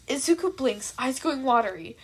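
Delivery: ordinary delivery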